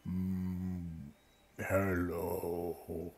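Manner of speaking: low, soft, drawn-out groan; big tree voice. Acted. Sick tree greeting the visitor, deep slow voice. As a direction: rumble it slowly like an ancient tree. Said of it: "a big booming voice from the tree goes".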